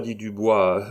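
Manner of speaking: usual speaking voice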